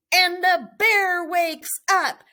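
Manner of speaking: high, loud sudden announcement; big dramatic storytelling beat; dramatic wake-up. A narrator's reading. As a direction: jolt the listeners awake.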